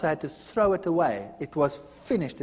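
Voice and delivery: ordinary delivery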